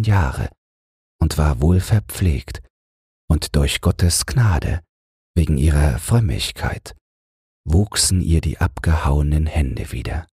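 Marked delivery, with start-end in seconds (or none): none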